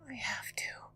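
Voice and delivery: soft, broken whisper through tears; tearful whisper. Acted. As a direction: whisper it while crying. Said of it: "I whispered".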